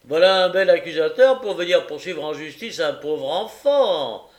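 loud, high, hammed-up pity; mock compassion. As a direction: put on fake sympathy. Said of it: "efforts comiques pour avoir l'air compatissant".

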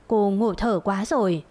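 usual speaking voice

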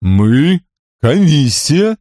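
slow, drawn-out giantess voice; booming giantess. Acted. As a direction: drone it slowly like a big woman. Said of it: "великанша прогудела".

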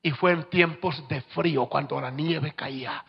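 usual speaking voice

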